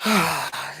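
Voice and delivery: long audible sigh. Real, not acted. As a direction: let out a breath of exasperation. Dog will not come.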